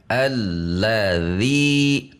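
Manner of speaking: "Alladzi" slowly, with one long swing on dzi; satu ayunan. Shown as a demonstration. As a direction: press and lengthen the dzi of "Alladzi". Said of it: "nekan, satu ayunan". ("Alladzi" dengan dzi ditekan satu ayunan)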